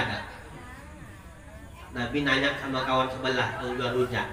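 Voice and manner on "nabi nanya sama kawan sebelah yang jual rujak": usual speaking voice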